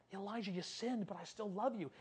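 very soft, gentle divine voice; hushed, forgiving tone; tender reassurance. Acted. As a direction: console him quietly. God gently consoling the broken prophet.

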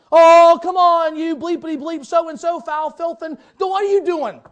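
very loud, high, slow hollering; rowdy bleeped greeting. Retold or imitated. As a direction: bellow it across the room at a buddy. Retold profane greeting to friend.

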